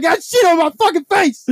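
very high, loud imitation of horror; hammed-up shriek of disgust; disgusted retold yell. Removal guy soiled under the body, disgusted yelling.

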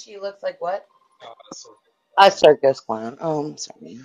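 ordinary talk

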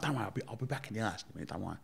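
calm her down quickly and softly; rushed soft reassurance to a crying friend; hushed reassurance. Retold or imitated. Reassuring crying Pamela retold.

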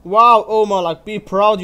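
loud mock-praise hurled at a teammate; sarcastic shout. Real, not acted. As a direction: yell be proud with bitter sarcasm.

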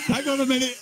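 high-pitched imitated interruption; distracted planespotter impression; Jerry Dyer. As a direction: halt the commentary in an excited Essex voice. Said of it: "He'll go".

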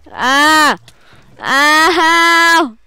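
high, loud drawn-out cries; hammed-up howls of pain; pained game yelps. Player burning in the game, mock pained yelps.

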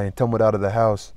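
plain reading voice

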